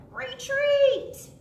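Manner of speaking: high, loud panicked "Retreat!"; startled pirate crying retreat; scared cry. Acted. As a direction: squeal it in fright. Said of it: "the captain cried".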